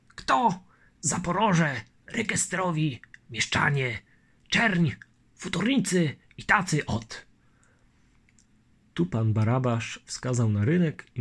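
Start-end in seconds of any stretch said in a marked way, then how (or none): 0.1-7.3 put-on higher, anxious old man's voice; fretting old colonel; old colonel worried. Barabasz fearing Chmielnicki's escape, worried old voice.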